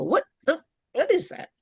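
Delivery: mock puzzlement, jumping up in pitch; baffled, pitch leaping on the question; puzzled reaction. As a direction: act out a baffled first hearing. Acts out hearing something first time.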